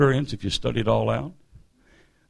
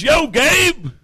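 high, loud hailing shout; boisterous "yo" across the room; hearty American greeting; 12 semitones higher, 6 dB louder than usual. Acted. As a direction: call out to a buddy in American slang. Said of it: "Act American".

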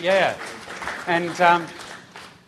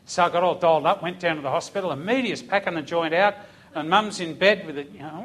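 ordinary talk, nothing marked

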